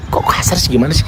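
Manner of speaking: ordinary delivery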